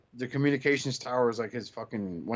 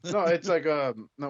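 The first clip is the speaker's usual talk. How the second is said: ordinary talk, nothing marked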